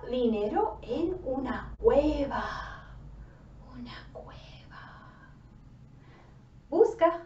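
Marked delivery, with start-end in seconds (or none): 3.7-5.3 whisper. Acted. Spooky cave in the story.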